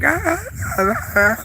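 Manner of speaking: high, cracking "ah" croaks; lost-voice imitation. Vlogger showing viewers how her voice sounded, imitated croaky squeaks. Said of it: "my voice was like".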